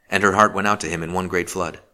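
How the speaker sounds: ordinary delivery, nothing marked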